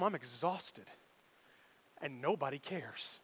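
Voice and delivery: quiet everyday exhaustion; weary. Shown as a demonstration. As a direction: let it sink like a long day. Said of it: "when I get home".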